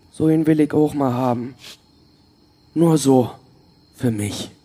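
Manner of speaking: ordinary delivery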